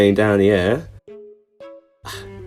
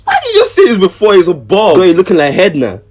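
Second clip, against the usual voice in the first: shrieking; 7 semitones higher, 7 dB louder, faster than usual. Real, not acted. Shrieking at the bald cut.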